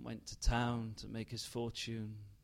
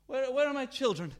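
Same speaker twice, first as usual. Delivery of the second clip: higher, louder, frantic question; panicked search; 11 semitones higher, 6 dB louder, faster than usual. Acted. Mother frantically asking where her children are. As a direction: call out in rising panic.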